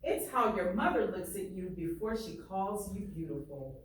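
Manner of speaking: ordinary talk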